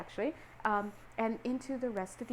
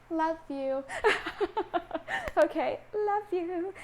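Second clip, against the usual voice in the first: real laughter, higher voice; laughing affection; 7 semitones higher, slower than usual. Real, not acted. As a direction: say it through giggles, pitched up. Laughing after teasing the listeners.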